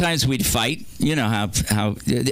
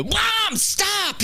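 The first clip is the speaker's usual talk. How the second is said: high yelled plea to stop; yelling at mom. Retold or imitated. Kid yelling at his spaced-out mom to stop. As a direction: snap her out of it with a yell.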